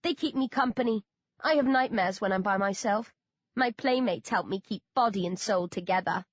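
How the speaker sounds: usual speaking voice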